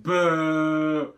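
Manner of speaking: loud mock boo; playful boo. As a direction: jump out and spook someone. Mock-scaring after the flashback.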